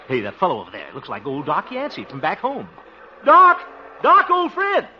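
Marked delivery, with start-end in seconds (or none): 3.3-4.9 high loud eager hail; calling out. Acted. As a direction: call across the street joyfully. Calling out across street.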